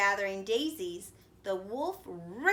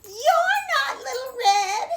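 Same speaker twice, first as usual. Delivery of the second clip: very high loud panicked cry; frightened cry; 10 dB louder than usual. A narrator's reading. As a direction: shriek it in alarm. Granny frightened.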